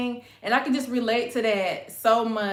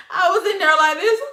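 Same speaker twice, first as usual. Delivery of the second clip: laughing mock cry; 8 semitones higher, 6 dB louder than usual. Acting out crying over the movie.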